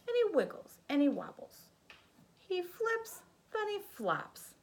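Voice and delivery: put-on lively read-aloud for a child; sing-song picture book narration; storybook reading. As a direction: read it like bedtime story time.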